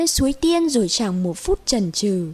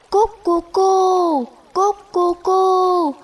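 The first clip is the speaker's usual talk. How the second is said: high, slow, drawn-out coo; dove cooing; 7 semitones higher, 4 dB louder, slower than usual. Acted. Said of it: "Nói rồi chim vỗ cánh bay đi". (tiếng chim cu gáy cao, kéo dài)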